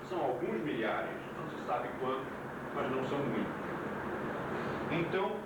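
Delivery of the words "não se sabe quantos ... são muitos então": ordinary talk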